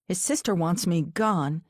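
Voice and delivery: ordinary delivery, nothing marked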